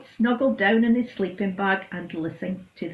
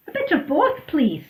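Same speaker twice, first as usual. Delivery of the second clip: eager bit of both; 7 semitones higher, 5 dB louder than usual. Acted. Goat answers Donkey's questions.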